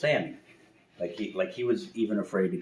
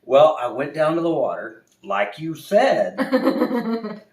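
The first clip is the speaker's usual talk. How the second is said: higher, louder, slow, half laughing; scared bear; 7 semitones higher, 9 dB louder than usual. Acted. Bear to the owl, slow scared report. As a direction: report it like a spooked cub. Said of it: "He says".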